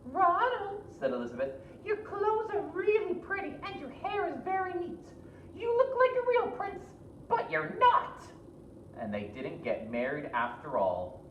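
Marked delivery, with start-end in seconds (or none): 0.1-0.8 very high, sweet princess; high flattering princess. Acted. 1.9-8.2 very high, sweet princess; high flattering princess. Acted.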